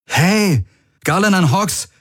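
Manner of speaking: high, sharp call for attention. A narrator's reading. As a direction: call to a friend who isn't responding. Calls to a dazed crewmate.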